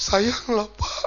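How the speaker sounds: mock sobbing, breaking up the words; imitated tearful wife. Preacher voicing a wife asked about her sick husband, mock tearful. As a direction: act out a wife blurting it through tears. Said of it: "tiba-tiba dia nangis".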